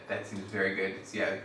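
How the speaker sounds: ordinary delivery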